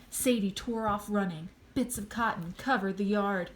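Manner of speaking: ordinary delivery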